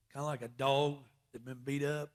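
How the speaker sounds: usual speaking voice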